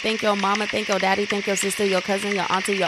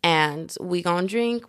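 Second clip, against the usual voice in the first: normal talking voice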